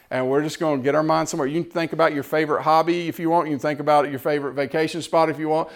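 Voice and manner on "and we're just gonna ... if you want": usual speaking voice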